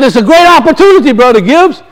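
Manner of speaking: high, fast eager pitch; high fast excited preacher; enthusiastic. Retold or imitated. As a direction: sell it with cheerful zeal. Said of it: "He said".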